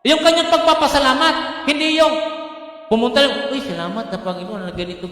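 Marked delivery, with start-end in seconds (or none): 3.5-5.1 much softer, casual throwaway thanks; preacher mocking a lazy thank-you; offhand thanks. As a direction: toss off a careless thank-you. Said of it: "Hindi ganun yung ginawa dito".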